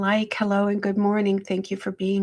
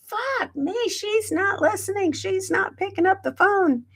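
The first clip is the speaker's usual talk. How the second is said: frantic, much higher pitched exasperation; frantic high outburst; 11 semitones higher than usual. Retold or imitated. Retelling her panic when mom won't pick up, high frantic. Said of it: "I literally made a scene".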